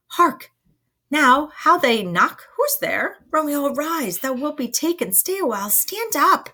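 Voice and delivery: high, urgent stage whisperless alarm; anxious Friar. Acted. Friar hears knocking and warns Romeo to rise, urgent.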